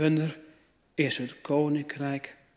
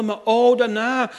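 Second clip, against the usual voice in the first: higher, louder lament of a believer who can't break through; voicing a despairing listener, higher and louder; struggling churchgoer; 7 semitones higher, 6 dB louder than usual. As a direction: complain like a frustrated churchgoer. Preacher voices a struggling churchgoer.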